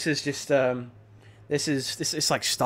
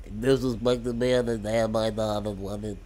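lower, softer put-on mobster voice; the Godfather; 5 semitones lower, 7 dB quieter than usual. Joining in on the movie bit, Godfather impression. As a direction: mumble it as a mob boss.

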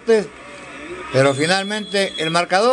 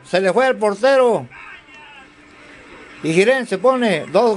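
excited goal call; 4 semitones higher than usual. Real, not acted.

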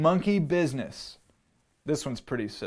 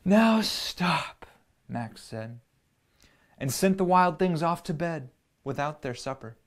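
put-on gentle storybook read-aloud for kids; reading to children. A narrator's reading. Parent reading a picture book to children, gentle storyteller voice. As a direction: tell it like a bedtime story.

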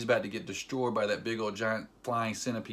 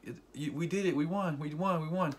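higher-pitched, pleading retold voice; raised, softer mock-emotional voice; Spider-Man; 4 semitones higher, 4 dB quieter than usual. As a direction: lightly imitate an overwhelmed youngster. Retells Spider-Man's tearful words.

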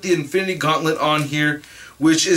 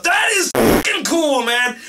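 excited; 9 semitones higher than usual. Real, not acted. Still geeking out over figure.

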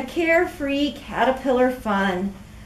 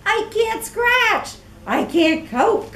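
whiny complaint; 2 semitones higher than usual. Acted. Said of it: "complained Katisha".

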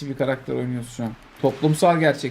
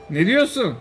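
ordinary talk

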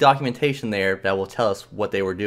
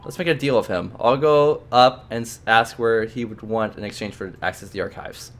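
usual speaking voice

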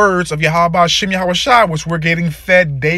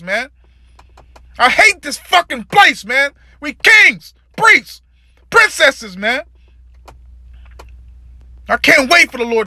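pained; 8 semitones higher than usual. Real, not acted. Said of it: "You can hear the pain in my voice".

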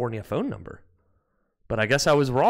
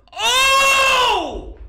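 high, loud drawn-out "oh"; long anguished yell; 6 dB louder than usual. Real, not acted. Streamer crying out at the game, long anguished yell. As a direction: wail it out at full volume.

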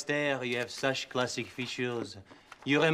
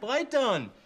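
ordinary talk, nothing marked

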